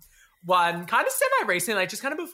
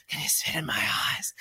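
breathy, imitated pant into an ear; panting whisper; 8 semitones lower, 5 dB quieter than usual. Boy whispering into partner's ear while making out, panting whisper. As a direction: whisper it low, mimicking a panting lover. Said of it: "that kind of like panting voice in my ear".

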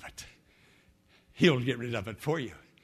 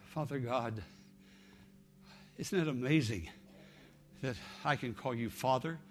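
prayer; 6 dB quieter than usual. Acted. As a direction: pray softly over music. Leads a prayer.

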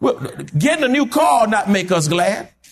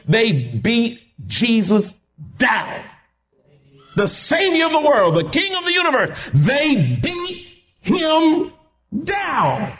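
high, slow preacher's cry; preacher crying out the beating, slow; preaching at full force; 5 semitones higher than usual. Acted. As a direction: stretch it out like a climax.

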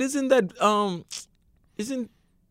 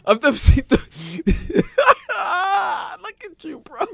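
higher voice cracking up; crying with laughter; 6 semitones higher than usual. Real, not acted. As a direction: break into helpless laughter mid-sentence. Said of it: "I'm crying".

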